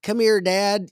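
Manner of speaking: put-on high eager kid calling his dad; a child tugging at his father. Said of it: "like the young kid".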